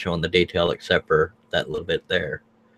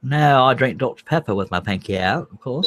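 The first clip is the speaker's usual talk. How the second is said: higher-pitched snooty British put-on; posh British accent; 5 semitones higher than usual. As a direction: play the snooty Brit, pitched up. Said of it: "says I sound posh".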